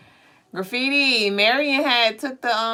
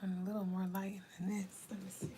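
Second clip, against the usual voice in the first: whispering; 19 dB quieter, faster than usual. Real, not acted.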